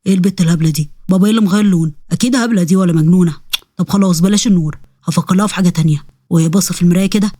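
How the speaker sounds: low-pitched demon thinking aloud; puzzled, low demon voice; scheming demon. Acted.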